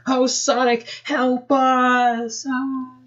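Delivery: high, far wailing plea; a high girlish wail for help. Acted. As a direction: Nicole wailing to herself in a high voice. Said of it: "she wailed to herself".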